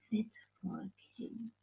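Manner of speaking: soft unvoiced whisper; hushed storyteller whisper. A narrator's reading. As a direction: breathe it like a secret. Whispered end of narration.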